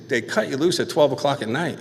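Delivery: ordinary talk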